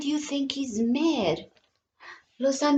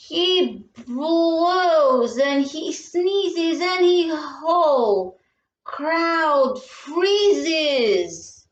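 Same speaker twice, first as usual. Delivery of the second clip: usual speaking voice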